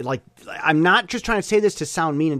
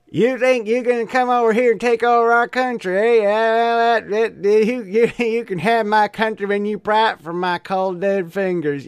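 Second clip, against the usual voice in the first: higher-pitched, run-on Texan rant; Texan accent; 6 semitones higher, 3 dB louder than usual. Acted. Texas guy telling newcomers off for taking over, indignant Texan accent. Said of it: "met this guy from Texas".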